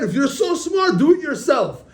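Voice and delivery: high, loud challenge; storyteller's indignant shout; exasperated retort. Retold or imitated. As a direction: act out giving up and throwing it back. Retold parting retort to the tempter.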